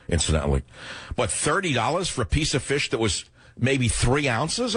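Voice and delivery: usual speaking voice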